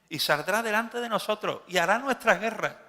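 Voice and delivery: loud high proud declaration; proud demand. Acted. The people boasting of their king, loud proud cry. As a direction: boast about the king who will fight. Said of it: "Y dijo".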